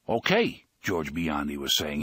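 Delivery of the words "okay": quizmaster thug. Acted. Gangster quizzing sleepy Henry.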